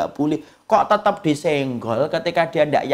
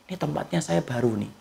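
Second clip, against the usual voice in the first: put-on soft baby voice; 4 semitones lower, 9 dB quieter than usual. Voicing newborn baby's thoughts.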